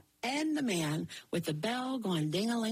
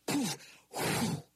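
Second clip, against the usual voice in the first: whooshing breath. A narrator's reading. Beanstalk bursts up.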